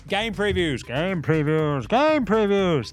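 announcer. Acted. Hyped segment announcer call.

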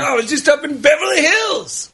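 high, shouted Eddie Murphy bit; Eddie Murphy impression. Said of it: "it's Eddie Murphy".

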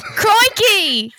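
hammed-up Australian exclamation; mock Australian accent. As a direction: blurt it out as a cartoon Australian. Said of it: "No, wrong place".

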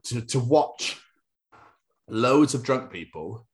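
ordinary talk, nothing marked

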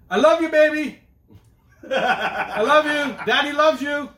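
loud, high, drawn-out holler; embarrassing shout. Acted. Dad embarrassing his daughter in the lineup, shouting. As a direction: holler it proudly across the schoolyard. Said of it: "I'll embarrass her later on".

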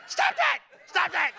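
very high, loud frightened shriek; startled mother shrieks at her son. Retold or imitated. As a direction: shriek at him to stop. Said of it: "and she went".

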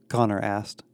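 usual speaking voice

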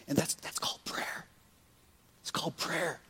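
hushed whisper, barely voiced. Real, not acted. Pastor whispering to congregation about prayer. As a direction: lean in and breathe the words.

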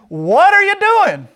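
very high, loud, drawn-out squawk; slow, high-pitched outraged donkey voice; indignant talking donkey. Acted. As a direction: stretch it out in a shrieking cartoon animal protest. Voices donkey being beaten.

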